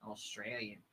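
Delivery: Australian accent. Shown as a demonstration. Host demos her Australian accent to chat, low and soft. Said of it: "the Australian is tall".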